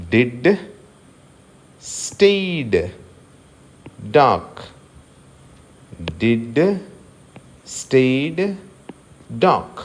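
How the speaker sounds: డాక్ with no r, hard d; silent r. Shown as a demonstration. Teacher models d words with silent r. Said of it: "ఇక్కడ కూడా ఆర్ని మనము పలకడం లేదు".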